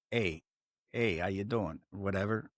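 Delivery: put-on a failed Canadian accent that comes out Chicago; mock Canadian accent. Host jokingly greets like a Canadian, weak Canadian accent. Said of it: "So that was my Canadian voice".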